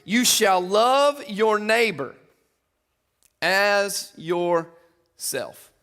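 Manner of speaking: very slow, every word drawn out; slow emphatic reading. Real, not acted. Preacher reading the second commandment to the church, very slow. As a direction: let each word land slowly and heavily.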